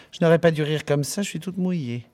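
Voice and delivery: high, winded giggly voice; breathless after laughing. Acted. Barmaid Miss Douce worn out from laughing, high and breathless. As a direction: spent from laughing, gasping it out. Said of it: "soupira mademoiselle Douce".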